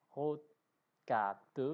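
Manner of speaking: "rotate" as loud, separated syllables; separate syllables. Shown as a demonstration. Teacher reading scrambled syllables for an exercise, "rotate" syllable by syllable. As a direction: read "rotate" out loudly, syllable by syllable. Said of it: "remettez les syllabes dans l'ordre".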